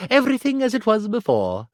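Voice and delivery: usual speaking voice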